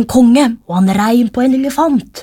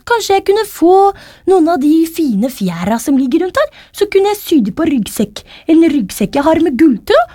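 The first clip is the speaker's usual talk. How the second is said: higher-pitched, hopeful character voice; Thomas's storybook voice; 7 semitones higher than usual. A narrator's reading. Thomas asks Reidar for feathers, high character voice. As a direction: lightly voice a small hero making a request.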